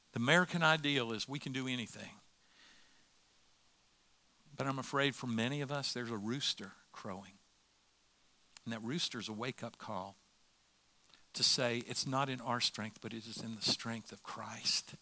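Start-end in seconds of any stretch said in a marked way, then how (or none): none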